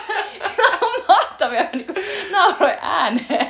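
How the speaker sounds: high, laughing talk. Real, not acted. Praising the film, still laughing high.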